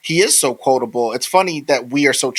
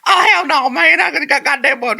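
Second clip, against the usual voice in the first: put-on hillbilly accent; 4 dB louder than usual. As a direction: whoop it up like a rowdy Southern hillbilly, pitched way up. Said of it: "his particular Austrian accent is like".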